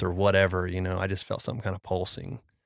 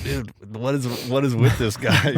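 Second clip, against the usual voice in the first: loud, higher incredulous retort; mock bafflement, raised and louder; baffled; 3 semitones higher, 5 dB louder than usual. Friends puzzling over the strange guy, baffled.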